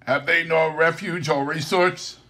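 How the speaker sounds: put-on high, loud pleading old man; Scrooge crying out. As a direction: plead desperately. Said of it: "cried Scrooge".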